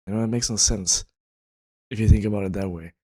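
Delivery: normal talking voice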